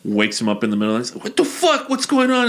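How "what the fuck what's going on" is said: high-pitched, bewildered mock alarm; panicked squeal of confusion, played for laughs; startled outburst. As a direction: act rudely woken up, voice shooting up high. Abraham woken up by God.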